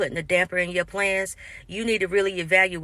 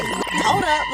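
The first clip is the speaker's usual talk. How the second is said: sudden realization; 9 semitones higher, 4 dB louder than usual. Retold or imitated. Catching the manipulation.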